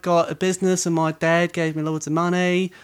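a hint of Brummie in the Essex voice; essex accent. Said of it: "Sounds a bit brummy".

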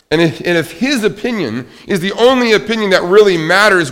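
loud, high, emphatic sermon delivery; ringing pulpit proclamation; impassioned preaching. Real, not acted. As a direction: preach it with force, big pauses on key words. Preaches with rising force.

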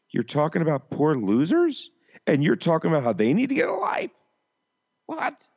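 rising, high-pitched disbelief; incredulous. Retold or imitated. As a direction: climb into a squeaky, baffled "what?". Disbelief at the kid's bragging.

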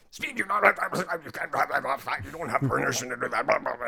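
hammed-up type A cartoon dad rant; Pops. Guest acting a cartoon father scolding the son, high blustering rant. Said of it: "he was just so type A".

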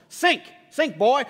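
high, loud mock jeer; acted gloating order; scornful. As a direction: taunt him as he sinks. Mock condemning Jesus.

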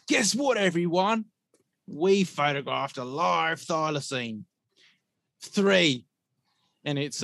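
high, loud, over-excited fake announcement; mock announcer. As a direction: hype it up like a bogus discovery video. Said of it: "you release a video and you say".

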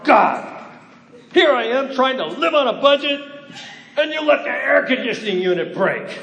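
loud, high, exasperated complaint; complaining shout to God. Acted. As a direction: cry out to God in frustration. Voicing a homeowner's complaint prayer.

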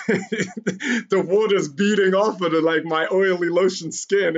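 amused, words slowed by laughter; laughing while talking. Real, not acted. Telling how water beaded off lotioned skin, laughing.

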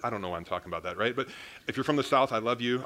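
ordinary talk

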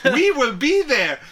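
put-on high, loud, slow Russian accent. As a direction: ham up a heavy Russian accent. Russian joke voice.

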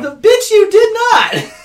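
high, loud, drawn-out comeback; hammed-up yell to shut down a false claim; mock outraged shout. Acts out own outraged comeback.

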